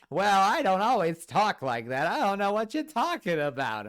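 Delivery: loud, high, drawn-out cartoonish protest; John Mulaney. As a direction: protest like an old New York comedian. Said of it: "like, John Mulaney type person".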